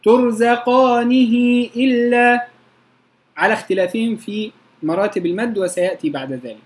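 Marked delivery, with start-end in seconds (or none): 0.0-2.5 slow recited ترزقانه إلا with long madd on إلا; recited reading. Shown as a demonstration. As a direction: chant ترزقانه إلا slowly, stretching إلا. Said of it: "باقي القراء".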